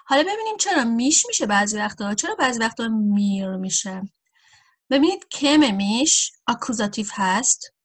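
normal talking voice

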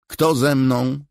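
high, loud appeal; repeated call. A narrator's reading. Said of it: "powtórzyła". (ponowne głośne wołanie)

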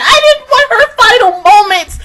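tearful shout, high and loud; crying out. Real, not acted. Fan protesting the character's death, crying and shouting. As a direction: wail it, half laughing, half crying.

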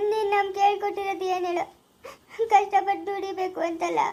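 slow, weeping questions to her father; tearful pleading. Acted. Daughter weeping to her father, slow and tearful. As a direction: cry it out, asking why the poor suffer. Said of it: "ಅಳ್ಬೇಡ ಮಗಳೇ".

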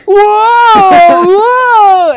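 loud, very high drawn-out yell; retold whoa. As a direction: imitate a pilot thrown into a spin, yelling high. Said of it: "and he's like".

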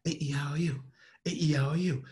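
quick, contained vowel run; hushed-edged rapid drill; low and fast. Shown as a demonstration. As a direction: run the letters together without shouting. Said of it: "low and fast".

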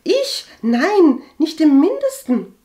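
very high, loud denial; protesting innocence. A narrator's reading. Cousin denies believing gossip about Agathe, protesting. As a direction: squeal a shocked denial.

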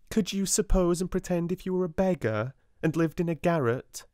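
lightly voiced older girl. A narrator's reading. Lavinia's challenging question.

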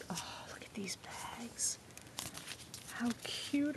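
soft awed whisper. Real, not acted. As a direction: whisper it, delighted. Whispered awe at bags.

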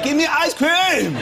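slow, high-pitched mock tantrum yell; shouting four-year-old. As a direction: bellow each word like a child demanding a treat. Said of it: "he thinks you have to shout".

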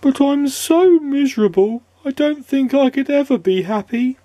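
high, squeaky and hopeless; high woeful squeak; miserable squeaky voice. A narrator's reading. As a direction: moan it in despair. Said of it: "he added miserably".

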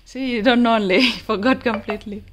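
loud laughing tease; teasing laugh. Real, not acted.

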